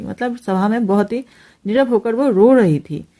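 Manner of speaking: ordinary delivery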